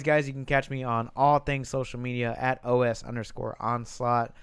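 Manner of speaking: normal talking voice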